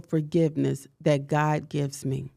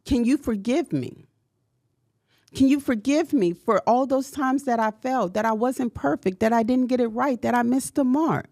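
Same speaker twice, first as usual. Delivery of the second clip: impassioned, higher-pitched plea for forgiveness; pleading prayer; 6 semitones higher than usual. Acted. Preacher prays aloud to God for forgiveness, impassioned. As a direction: pour it out to God, asking again and again.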